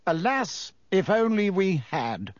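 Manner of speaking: bitter. A narrator's reading. Minister correcting the landlady, understated bitterness. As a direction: let regret show, bitterly. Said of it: "said Fudge bitterly".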